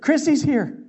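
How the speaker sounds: high, loud excited news; wife bursting in, excitedly announcing. Retold or imitated.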